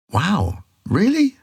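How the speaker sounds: high-pitched, wondering disbelief; acted amazement. Noticing a life-changing small comment, acted amazement. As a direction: let the pitch leap up in wonder.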